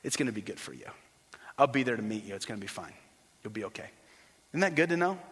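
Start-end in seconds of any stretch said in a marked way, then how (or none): 0.0-3.9 very soft, low comforting voice; hushed, gentle promise that all is well; tender reassurance as Jesus. Acted. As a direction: soothe someone afraid of dying. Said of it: "Jesus would say to you".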